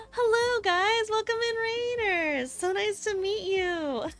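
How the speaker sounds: high, sweet welcoming voice; cute anime streamer. Real, not acted. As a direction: greet the raiders brightly. Welcoming raiders.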